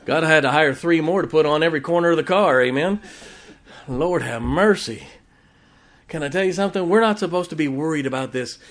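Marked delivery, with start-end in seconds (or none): none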